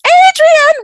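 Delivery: high, loud call of the student's name. Retold or imitated. Teacher calling Adrian back after class, high loud call. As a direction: call her back before she leaves.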